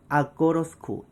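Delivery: "아꼬러스쿠" run together as one word; "I go to school" reduced to "ah-go-ruh-skool". Shown as a demonstration. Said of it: "이렇게까지 가는 거예요". ("아꼬러스쿠"로 한 단어처럼 이어서)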